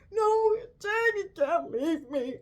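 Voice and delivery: lightly voiced whiny plea with a hint of sobbing; mock pleading Will. Vlogger mocking Will begging Jane to stay, whiny. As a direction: imitate him begging her not to go. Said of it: "he's like".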